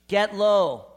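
loud preached command; strong, ringing "Get low." to the congregation; forceful exhortation. Shown as a demonstration.

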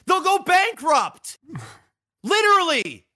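slow, high, emphatic shout; heated shout. Real, not acted. Ranting to his co-host about landlords, shouting. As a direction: yell it out in disbelief.

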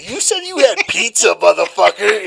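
high, loud demand breaking into laughter; laughing mock demand. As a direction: laugh while calling her out. Demanding the promised pizza.

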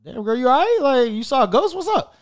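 concerned surprise. Retold or imitated. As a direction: ask what's wrong, eyebrows up. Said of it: "I'm just looking at her like".

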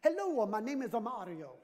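mock Italian accent; sing-song, hammed-up Italian accent; granddaughter's Italian. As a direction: imitate a child's attempt at an Italian accent. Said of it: "Here's her Italian".

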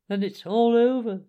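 high, loud despairing wail; tearful Toad, voice pitched high; acted sobbing. A narrator's reading. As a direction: lament it, mock-sobbing. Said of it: "sobbed the Toad".

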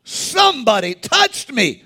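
high, slow startled cry; startled Jesus. Acted. Preacher voicing Jesus noticing the touch, startled. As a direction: stop short and wonder aloud. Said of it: "He's like".

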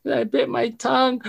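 put-on high, whiny little-kid wail; imitating crying son. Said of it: "and he's crying".

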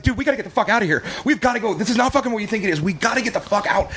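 high-pitched, urgent escape plea; retold frantic urging. Plain-clothes rescuer urging captive to get out, frantic. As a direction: act out someone desperate to flee.

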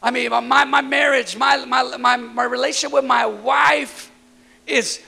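loud, high preacher's call; rising sermon shout; preaching at full force. Acted. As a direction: preach it out with fire.

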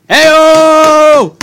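hammed-up punchline yell; cheering own dirty joke; hey-oh shout.

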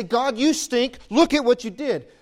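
loud, high accusation thrown at God; mock angry outburst. Believer blaming God, loud mock accusation. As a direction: shout it up at God in mock outrage. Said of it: "and say".

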